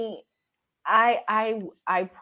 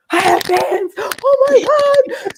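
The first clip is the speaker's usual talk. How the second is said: high, loud fangirl squeal; thrilled disbelief; 10 dB louder, much slower than usual. Retold or imitated. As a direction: stretch it into an overwhelmed squeal. Amazed at having fans herself.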